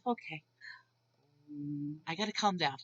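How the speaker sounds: low, soft voice, calming yourself down; muted self-talk. Retold or imitated. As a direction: talk yourself down under your breath.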